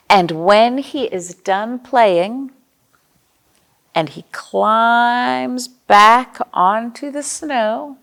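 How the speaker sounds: gentle picture-book narration with playful questions; reading to children. Acted. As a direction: read it like story time for little kids.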